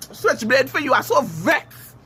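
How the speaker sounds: usual speaking voice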